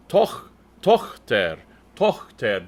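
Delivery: Tochter with a throaty ch, not a K; ach-laut Tochter. Shown as a demonstration. Director teaching tenors the ach-laut, slow German. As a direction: breathe the ch in Tochter. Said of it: "make it an Ach laut".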